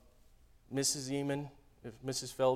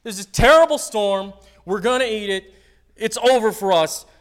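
panicked apostles; 8 semitones higher, 15 dB louder than usual. Retold or imitated. Apostles freaking out in the storm, panicked shout. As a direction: yell like the boat is sinking. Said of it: "They're like".

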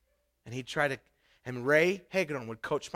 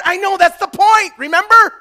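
louder, higher sermon voice building to shouts; full-force preaching; 12 semitones higher, 15 dB louder than usual. Acted. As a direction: hammer home the call to make disciples. Preaching the call to disciple.

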